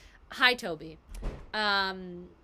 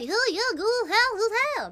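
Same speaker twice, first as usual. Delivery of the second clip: mock Applin gibberish; 12 semitones higher, 10 dB louder than usual. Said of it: "what half of them sounds like to me".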